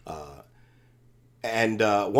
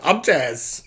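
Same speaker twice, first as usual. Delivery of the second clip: Taz voice; 4 semitones higher than usual. Retold or imitated. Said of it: "Taz is like".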